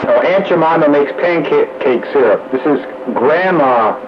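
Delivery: ordinary delivery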